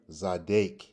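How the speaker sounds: Zadek with a long A, ending "dake"; second pronunciation. Shown as a demonstration. Teacher offers the other pronunciation, Zadek with a long A. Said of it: "two different pronunciations".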